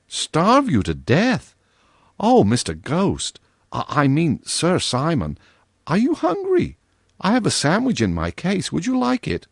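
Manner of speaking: put-on worried little girl offering her sandwich; lightly voiced girl, anxious; girlish concern. Virginia's surprised concern.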